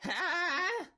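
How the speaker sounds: high, frantic gnome shrieking in terror; panicked gnome. Acted. Gnome yelps in fear.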